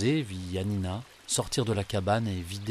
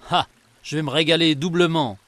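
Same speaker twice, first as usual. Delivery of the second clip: louder, higher hungry genie crowing over his catch; gleeful exclaiming genie; 6 semitones higher, 6 dB louder than usual. A narrator's reading. Forest genie gloating over two captives, loud and gleeful. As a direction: exult like a greedy forest giant. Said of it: "s'exclama le génie".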